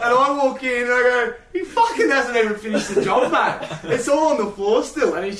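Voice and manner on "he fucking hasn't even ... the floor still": laughing disbelief. Retold or imitated. Indignant retelling, laughing.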